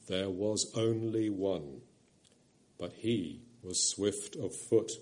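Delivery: a low, slow, gentle lion's voice. A narrator's reading. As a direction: rumble softly like a kind great lion. The Voice of Aslan.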